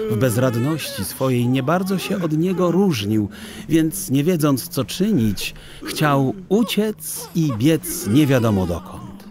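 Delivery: usual speaking voice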